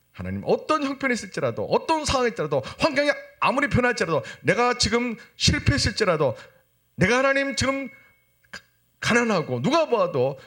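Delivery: high, building run of even-ifs; impassioned preaching. Acted. Preacher vows faith whatever the circumstances, impassioned preaching. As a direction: pile up each hardship on a high pitch.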